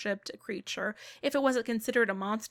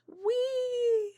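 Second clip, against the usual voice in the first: gleeful whee; 13 semitones higher than usual. Acted. Spirit joyfully carried to beyond.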